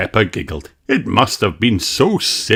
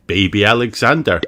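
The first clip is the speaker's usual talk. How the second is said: plain reading voice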